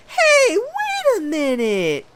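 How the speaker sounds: very high, slow falsetto quote; stretched falsetto Hey, wait a minute; squeaky mock voice. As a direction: squeak it out like a clueless kid. Same quote redone higher.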